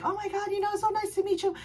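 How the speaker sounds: high, soft, gushing voice; imitated sweet greeting. Amanda meeting her fan, softly delighted.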